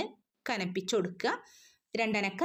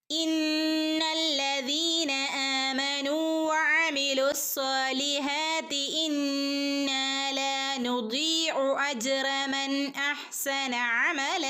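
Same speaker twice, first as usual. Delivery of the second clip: slow chanted verse recitation; Quran recitation; 4 semitones higher, much slower than usual. Acted. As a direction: intone the verse slowly and evenly. (ഖുർആൻ പാരായണം)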